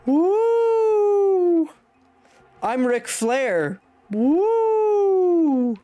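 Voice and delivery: high, loud, drawn-out wrestler whoops; ghostly Ric Flair. Retold or imitated. Streamer imitating Ric Flair as a ghost, high, loud and slow. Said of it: "he does it like a ghost".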